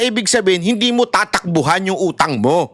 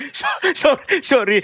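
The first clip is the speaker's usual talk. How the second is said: laughing apology; 6 semitones higher than usual. Real, not acted. Laughing apology after rant.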